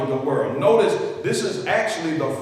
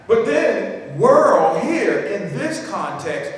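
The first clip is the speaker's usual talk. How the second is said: rising preaching; 2 semitones higher, slower than usual. Acted. Preacher warning church about the devil's world system, building intensity.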